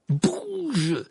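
high, drawn-out command; frantic one-word order; cried out. A narrator's reading. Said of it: "s'écria Harry".

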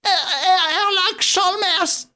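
a stammering, strangled Wilson. A narrator's reading. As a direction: choke out the name haltingly. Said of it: "balbutia une voix étranglée, caverneuse".

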